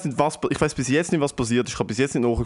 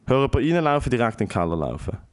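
ordinary talk

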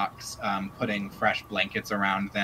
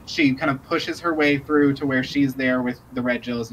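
normal talking voice

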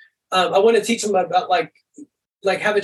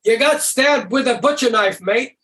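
higher, upbeat Australian voice, bad news said cheerfully; lightly done Aussie, breezy about a stabbing; mock cheery Aussie; 6 semitones higher, slower than usual. Guest mimics how Australians make bad news sound positive. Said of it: "Australians... it sounds positive".

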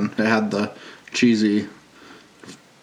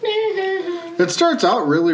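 high; much slower than usual. Acted. Stretched high on "really.

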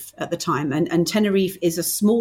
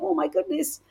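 higher, cringing inner voice; mock horror; 9 semitones higher than usual. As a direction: wince aloud in playful dismay. Cringing at early recordings.

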